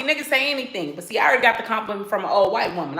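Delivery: ordinary talk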